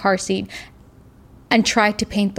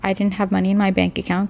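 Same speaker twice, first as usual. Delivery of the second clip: normal talking voice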